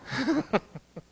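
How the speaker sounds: high scornful laugh; mocking laugh. Mocking the easy Sufism.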